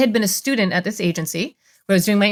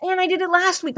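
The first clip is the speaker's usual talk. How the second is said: mirroring husband; 9 semitones higher than usual. Retold or imitated. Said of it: "he's like".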